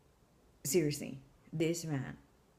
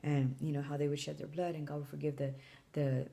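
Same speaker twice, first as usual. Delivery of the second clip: ordinary delivery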